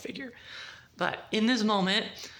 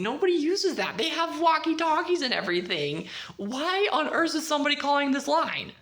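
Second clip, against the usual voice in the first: higher, puzzled, questioning; puzzled alarm; 8 semitones higher, 3 dB louder than usual. Retold or imitated. Julie hearing a dead line ring, higher puzzled voice. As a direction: sound baffled by the old phone.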